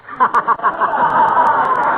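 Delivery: high breathy cackle at his own joke; chortle, delighted with yourself; self-pleased laugh. Acted. Husband laughing at his own pun, high cackle.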